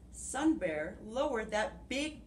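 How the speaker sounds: ordinary talk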